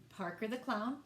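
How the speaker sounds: usual speaking voice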